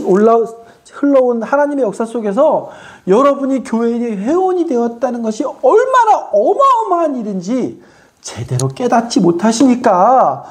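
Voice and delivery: high, stretched emphatic sermon delivery; impassioned preaching. Real, not acted. Preacher urging congregation to grasp their membership, impassioned. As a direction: drive home the wonder, pitched high.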